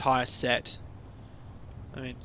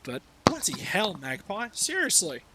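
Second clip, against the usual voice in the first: ordinary delivery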